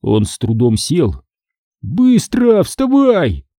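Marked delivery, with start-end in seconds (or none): 1.8-3.5 high-pitched urgent order; commanding. A narrator's reading. Chick ordering his injured friend to get up, commanding. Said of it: "скомандовал Цыпа".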